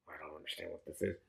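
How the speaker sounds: soft, low baffled murmur; quiet confusion. Real, not acted. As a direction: trail off, lost.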